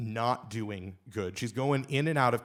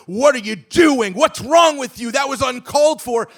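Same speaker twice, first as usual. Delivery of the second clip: high, loud angry yelling at a man; screaming crowd; 10 semitones higher, 13 dB louder than usual. Retold or imitated. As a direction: yell it like a furious front row. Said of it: "screaming at him".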